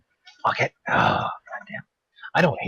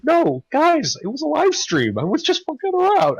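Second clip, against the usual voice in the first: much higher, pleading mock excuse; 3 dB louder than usual. Telling neighbors it was just a stream, high and pleading. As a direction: squeak it out like a nervous excuse. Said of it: "I'll be like".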